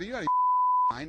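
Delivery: mock-shriek it at the fighter; a hammed-up cry of disbelief at the fighter for leaving the cage; retold outburst. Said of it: "I said".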